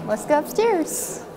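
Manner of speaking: usual speaking voice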